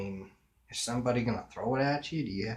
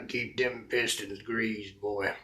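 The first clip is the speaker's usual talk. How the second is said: mock country mechanic; slower than usual. Playful mock country mechanic advice.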